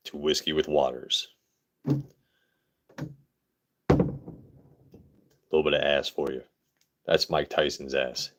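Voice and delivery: smooth radio DJ voice. Shown as a demonstration. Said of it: "Everyone likes the radio voice".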